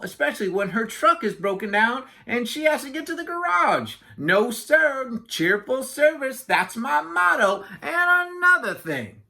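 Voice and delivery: high, self-important patter; indignant chatterbox Yak. Acted. Yak boasting to Walrus of his taxi service, chatty. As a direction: rattle on proudly as Yak.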